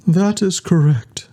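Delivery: whispered, eerie machine voice; strange electronic voice. A narrator's reading. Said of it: "A strange electronic voice".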